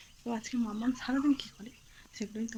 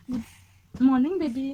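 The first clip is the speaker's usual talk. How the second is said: very slow, louder, stretched good morning; slow tender greeting; 8 dB louder, much slower than usual. Real, not acted. Mother greets baby.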